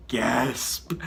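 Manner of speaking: breathless unvoiced gasp sound; whispered gasp. Shown as a demonstration. Hero losing air, breathy whispered gasp. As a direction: act out the gasp with breath only. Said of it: "I literally wrote, "Gasp!".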